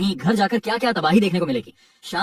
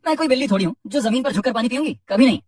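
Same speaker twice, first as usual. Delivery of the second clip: proud, higher voice, turning her nose up; haughty refusal; 7 semitones higher than usual. A narrator's reading. Cat boss refuses the floor bowl, haughty and proud. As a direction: scoff at the bowl with lofty pride.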